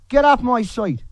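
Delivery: furious demand to leave; angry shout. Real, not acted. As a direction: yell it to throw them out. Angry rant against critics.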